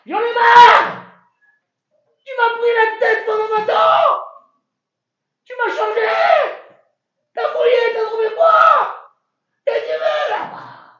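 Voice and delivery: high, very loud furious shouting; explosion of rage. Acted. Yaakov finally exploding at Laban, screaming. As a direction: let twenty years of rage burst out. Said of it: "l'explosion de Yaakov".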